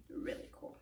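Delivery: playful stage whisper; whispered echo. Shown as a demonstration. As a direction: whisper it back, no voice.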